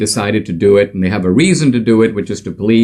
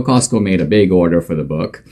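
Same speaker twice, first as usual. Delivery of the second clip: normal talking voice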